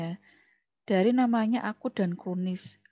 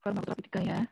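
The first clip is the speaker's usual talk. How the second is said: muttering to herself; 5 dB quieter, much faster than usual. Real, not acted. Lecturer murmuring to herself.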